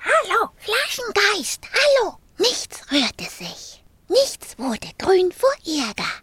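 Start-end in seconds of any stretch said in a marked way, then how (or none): 0.0-2.2 high, insistent hello; calling out. A narrator's reading. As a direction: call to someone inside a bottle. Calling into the shaken bottle.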